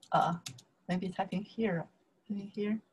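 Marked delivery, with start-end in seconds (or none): none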